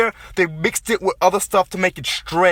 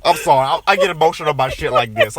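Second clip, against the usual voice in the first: ordinary talk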